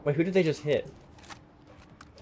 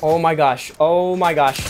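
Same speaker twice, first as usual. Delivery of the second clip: ordinary delivery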